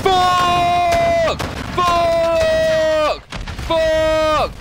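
yelling in frustration; furious shout; angry shouting. Real, not acted. Frustrated outburst at game.

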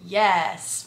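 "Yes" with a long hissed S on the end; drawn-out S. Shown as a demonstration. Reading a comment spelt with extra letters, "Yes" with a long trailing S. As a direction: stretch the final S of "Yes". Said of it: "with a bunch of S's".

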